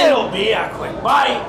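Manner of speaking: slow, low, taunting vampire. Acted. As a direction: tease them like hungry prey.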